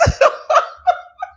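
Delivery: loud high cackling; helpless high laughing fit; real laughter. Real, not acted. Host cracking up after blocking viewer, loud laughter.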